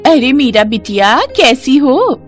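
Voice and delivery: drawn-out, lilting greeting; neighbour's singsong hello, rising high; friendly neighbour aunty. Acted. Neighbour greets Meera in story. (पड़ोसन aunty का लंबा खिंचा अभिवादन)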